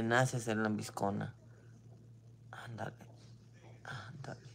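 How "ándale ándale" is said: softly whispered mocking goodbye; a dry, unvoiced brush-off. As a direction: breathe it out dismissively. Mocking her own curt goodbye.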